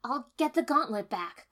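loud, high insistence; insistent. A narrator's reading. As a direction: insist on it hard.

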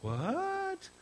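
squeaky high shocked what; stunned disbelief. Retold or imitated. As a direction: voice jumps way up in disbelief. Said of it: "I thought".

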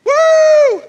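high, loud party whoop; hammed-up party yell at full volume; mock party cheer. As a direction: whoop like a kegger crowd. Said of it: "they come on".

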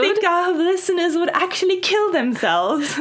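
put-on high British accent with laughs; mock British drawing-room accent. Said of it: "speak in a British accent".

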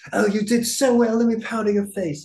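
very high, loud, fussing camp voice; camp partner. Retold or imitated. Partner fussing over his makeup, high camp voice. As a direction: fuss over him sweetly, high and camp.

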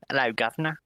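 lightly put-on British greeting; mock British accent. Guy showing why the UK is great, mock British accent. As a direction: play at a British street greeting. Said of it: "you can just go up and go".

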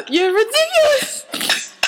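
high, squeaked through laughter; laughing. Real, not acted. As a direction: say it helpless with real laughter. Host overcome with laughter.

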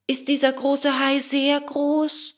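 high-pitched puppet question; high, naive character voice; puppet boy Bengele. A narrator's reading. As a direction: ask high as a little puppet.

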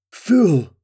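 high, loud alarmed shout; king crying out at the man climbing up. A narrator's reading. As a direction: cry out in alarm. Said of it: "Madorn cried".

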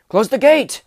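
high, loud shout from the walls; guards shouting. A narrator's reading. Guards on the wall shout the order, high loud call. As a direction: bellow the order down from above. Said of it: "came the shouts of the guards".

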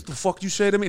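mock confrontational street talk; sudden gangster. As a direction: imitate a dad turning gangster. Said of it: "he turns gangster immediately".

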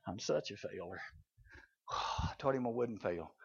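low, hushed, sighing regret; dejected self-reproach. Acted. Simon Peter tossing sleepless after denying Jesus, dejected self-reproach. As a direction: mumble it softly, sunk in failure.

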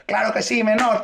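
high, eager agreement; high-voiced mock yes, kid; eager workers. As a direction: chirp it out like an eager helper. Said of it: "y los tipos".

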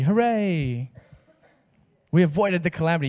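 sarcastic movie-style celebration; mock hooray. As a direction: cheer it like a cheesy movie ending. Mock cheer for happy ending.

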